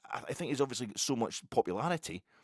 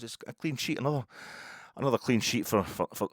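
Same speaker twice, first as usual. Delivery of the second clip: normal talking voice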